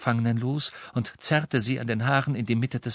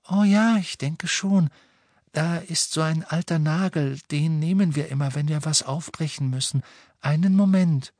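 put-on high, obliging girl; eager high-pitched character voice; light girl's voice; 6 semitones higher than usual. A narrator's reading. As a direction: answer helpfully in a raised, light voice. Narrator voicing the girl Luna.

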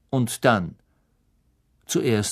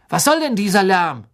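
a lightly voiced sleepy grumble, louder and higher; grumpy student; 6 semitones higher, 6 dB louder than usual. A narrator's reading. Woken student complaining about the racket, a little louder.

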